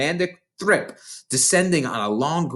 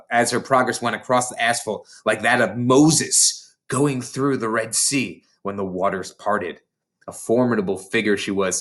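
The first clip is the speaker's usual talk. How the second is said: plain reading voice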